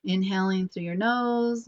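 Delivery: ordinary talk, nothing marked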